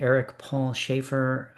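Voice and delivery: ordinary delivery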